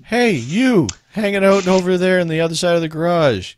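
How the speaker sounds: calling out. Acted. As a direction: holler over to a bystander. Acted calling across the garage.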